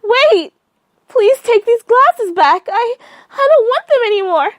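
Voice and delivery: woman begging the caller not to leave; desperate pleading scream. Acted. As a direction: plead in panic. Begging before he hangs up.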